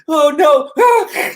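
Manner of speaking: high, loud mock panic; hammed-up fearful cry; panicked dog. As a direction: yelp like a frightened dog. Pushed soft dog panics.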